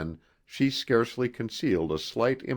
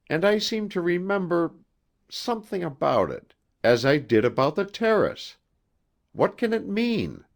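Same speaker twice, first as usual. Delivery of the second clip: higher, lightly voiced young wife, puzzled and uneasy; a bride troubled by half-memories, voiced higher; 7 semitones higher than usual. Margot's lines, voiced higher.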